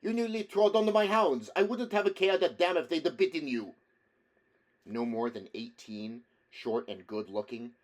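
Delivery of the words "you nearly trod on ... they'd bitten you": put-on raised, high voice scolding in an Italian accent; young Italian putting on manly outrage, loudly; Italian accent, indignant. A narrator's reading. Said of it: "cried in a noticeably Italian accent".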